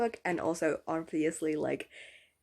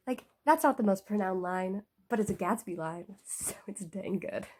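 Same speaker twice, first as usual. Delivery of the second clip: normal talking voice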